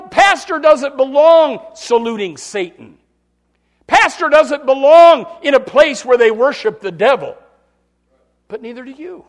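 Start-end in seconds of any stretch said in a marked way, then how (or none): 0.0-7.4 preacher shouting his point; full-force preaching. Real, not acted. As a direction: thunder it from the pulpit.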